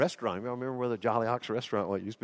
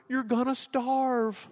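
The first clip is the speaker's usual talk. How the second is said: tearful mom; 12 semitones higher, much slower than usual. Retold or imitated. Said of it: "She starts crying".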